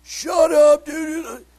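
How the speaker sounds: loud high-pitched holler; exasperated shout from someone woken up; woken sleeper's yell. Retold or imitated. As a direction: yell it across a bunk room. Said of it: "hear guys waking up going".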